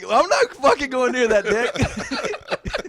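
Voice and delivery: high, slow, loud protest breaking into laughter; imitated disgusted friends; laughing mock refusal. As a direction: refuse in mock horror, cracking up.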